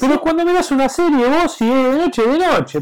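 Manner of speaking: loud, high heated argument. Real, not acted. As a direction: push the point hard.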